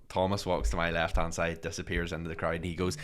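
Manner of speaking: ordinary delivery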